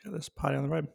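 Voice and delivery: talking with food in mouth; mouth full. Real, not acted. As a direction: speak through a mouthful. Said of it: "so I've got food in my mouth".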